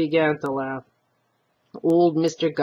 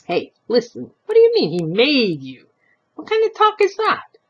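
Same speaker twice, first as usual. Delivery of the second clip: indignant; 7 semitones higher, 5 dB louder than usual. Acted. Old man demanding.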